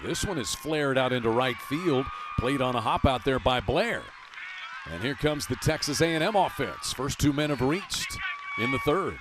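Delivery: lifted, energetic call of a base hit; excited play-by-play. Real, not acted. Announcer sees first two men reach in the third, excited play-by-play. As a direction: ride the rally upward as the offense gets going.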